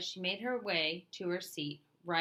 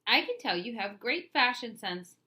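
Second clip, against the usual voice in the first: higher, louder sunny compliment; bright and cheerful; 5 semitones higher, 6 dB louder than usual. A narrator's reading. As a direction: flatter him with sparkle. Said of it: "Carla continued brightly".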